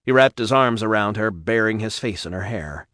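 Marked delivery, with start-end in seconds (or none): none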